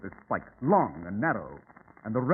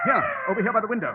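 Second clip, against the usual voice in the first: urgent call; 7 semitones higher, 4 dB louder, faster than usual. Acted. Calling partner to window.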